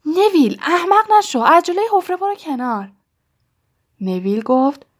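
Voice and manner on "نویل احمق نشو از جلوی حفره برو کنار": angry scolding. A narrator's reading. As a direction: snap at him to get out of the way. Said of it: "رون با عصبانیت گفت".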